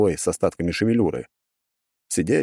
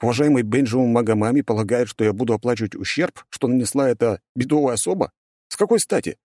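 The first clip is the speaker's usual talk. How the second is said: rising indignant protest; protest, outraged; indignant outburst; 4 semitones higher than usual. Acted. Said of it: "возмущается парень".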